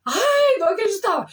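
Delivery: high, loud cry of disbelief; "Ai" squealed high in shock. Retold or imitated. Disbelief at discovering word. (espanto, grito agudo de incredulidade)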